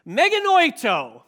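Meganoito called out high and loud with energy; excited. Shown as a demonstration. Preacher rousing a sleepy congregation, excited. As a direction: say Meganoito like you're alive and thrilled. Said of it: "you're excited about this".

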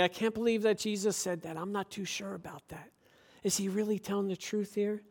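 soft doubting murmur; mock murmur. Church member muttering doubts to a neighbour, softly. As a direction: mutter the doubts under your breath. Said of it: "and we say".

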